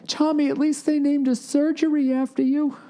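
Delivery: high-pitched wife voice, gently consoling. Acted. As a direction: play the wife trying to cheer him up. Wife consoling on ride home.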